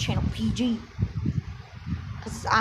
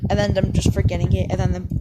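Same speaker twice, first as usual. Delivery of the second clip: ordinary talk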